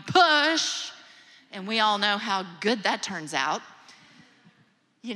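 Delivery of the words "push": mock yell. Husband yelling at wife in labor, loud mock shout. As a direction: Push shouted much higher and louder.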